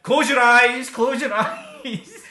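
loud, laughing command to close your eyes; mock shout. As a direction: shout it through laughter to hide the boxes. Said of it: "and I'm like".

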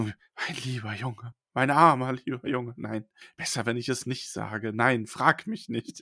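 very soft, slow and tragic; hushed dramatic pity. Retold or imitated. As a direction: murmur it like terrible news you dare not tell. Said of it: "presst sich die Hand ans Herz". (Trelawney zu Neville, leise und tragisch)

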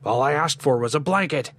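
high, aggrieved complaint; menacing undertone. A narrator's reading. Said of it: "There was a menacing undertone".

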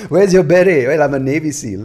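attempted French accent. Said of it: "for that attempted French accent".

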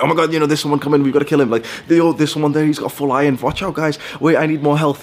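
high, urgent mock gaming chatter; imitating a young gamer on a voice call; excited gamer callouts. Acted excited Minecraft teammates calling out.

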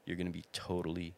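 soothing voice that drops to a whisper; soft reassurance. Retold or imitated. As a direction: talk him down gently, fading to a whisper. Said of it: "and I was like".

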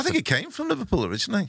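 high and fast, half-laughing; laughing joke. Pretend. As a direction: fake a fact while laughing. Mock-serious joke.

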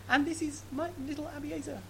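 high, tender little aside; voice of God aside. Retold or imitated. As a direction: play the fond aside of God. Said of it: "there's a parenthesis saying".

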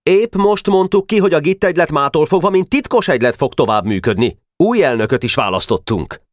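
high-pitched official announcement; boy president announcing. A narrator's reading. Club president informing the secretary, high and official. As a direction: make a formal club proclamation in a high boy's voice.

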